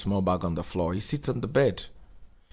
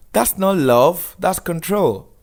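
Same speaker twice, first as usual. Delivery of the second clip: put-on much higher, louder, slow indignant girl; objecting girl; 9 semitones higher, 7 dB louder, slower than usual. A girl in the audience confronting Daniel, higher loud objection. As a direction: call him out firmly.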